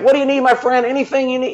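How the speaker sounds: high-pitched imitation of an East Coast accent; Dan Carroll. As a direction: put on the friend's accent, pitched well up. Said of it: "he's got this really great accent".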